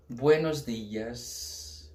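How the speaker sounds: buenos dias drawn out very slowly in Spanish. Shown as a demonstration. Teacher models buenos dias very slowly. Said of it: "que eu vou mostrar pra vocês é".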